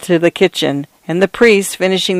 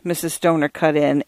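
ordinary delivery

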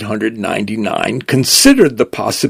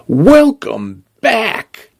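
cheerful greeting; 11 semitones higher, much slower than usual. Acted. Store greets returning vehicle.

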